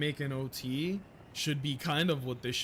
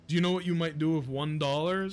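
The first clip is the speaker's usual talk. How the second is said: ordinary talk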